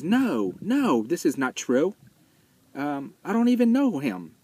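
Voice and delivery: high, hammed-up protest in a shaky attempt at an English accent; bad German-or-English accent. Scared Bruno denying his friend to the soldier, bad German-English accent. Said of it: "that's a bad German or English accent".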